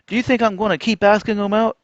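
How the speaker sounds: high, loud rhetorical question; exasperated retort. Acted. Fed-up man refusing to ask women out, loudly exasperated.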